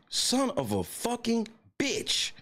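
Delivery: high, slow, drawn-out curse; anguished curse. Real, not acted. Fan cursing the lost game, high and slow. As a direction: wail it at the screen.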